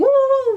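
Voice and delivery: loud, very high swooping "woo"; mock mystical woo. Retelling Aaron's Reiki tricks, mock whoop. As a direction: sing out a joking high whoop.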